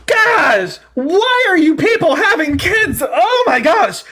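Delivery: high, loud disbelieving cry; exasperated outburst. Real, not acted. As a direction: throw up your hands and yell in disbelief. Exasperated shout at caller.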